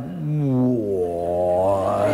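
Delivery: very low, stretched-out slow-motion voice. Acted. Preacher showing how slow he moves beside God, slow motion. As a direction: drag the word out deep, like time slowing down. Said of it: "you're going really slow".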